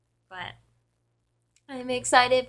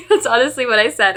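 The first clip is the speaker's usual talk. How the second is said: ordinary talk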